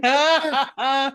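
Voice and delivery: high, loud yelp imitating fright; mock startled scream. Kid startled by chimp at the glass, imitated scream. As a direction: yelp as if jumping back. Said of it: "that guy jumped back and was like".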